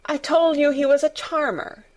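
lightly voiced boy, coolly correcting the nurse; austere. Colin rebukes the nervous nurse, mildly austere. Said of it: "said Colin austerely".

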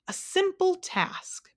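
very high and slow; hesitant humbug. A narrator's reading. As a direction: start it slowly, wishing to escape. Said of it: "began the humbug".

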